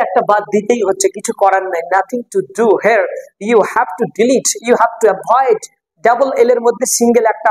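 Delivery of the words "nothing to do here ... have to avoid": slow emphatic rule. Shown as a demonstration. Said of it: "teacher stresses rule slowly".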